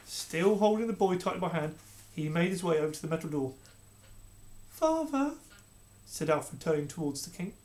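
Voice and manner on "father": put-on pleading boy. Alfred calls to King.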